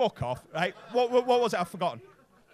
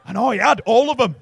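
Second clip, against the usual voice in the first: shouting; 3 semitones higher, 14 dB louder than usual. Real, not acted. Hyped storytelling to crowd.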